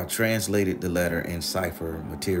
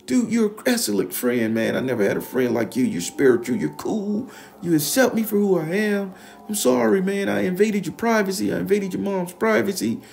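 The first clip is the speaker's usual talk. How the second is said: high, loud crying apology; blubbering Ralph; 13 semitones higher, 8 dB louder than usual. Retold or imitated. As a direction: sob out the apology while running to the car. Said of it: "he run into his car crying".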